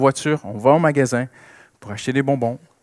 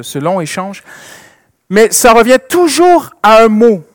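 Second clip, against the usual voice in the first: louder, emphatic sermon delivery; fervent preaching; 2 semitones higher, 13 dB louder than usual. Acted. Preacher building up Jean dix-sept to the church, louder and fervent. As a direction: drive the point home to the congregation.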